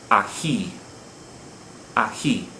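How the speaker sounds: aJÍ, weight on the end; final-syllable stress. Shown as a demonstration. Teacher showing why it has an accent, Ají stressed on JÍ. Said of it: "Here we're stressing the final syllable".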